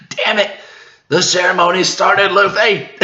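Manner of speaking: high, loud frustrated yell; Jinbei yelling. Acted. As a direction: shout at Luffy that the ceremony has started. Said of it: "great panel of Jinbei yelling out".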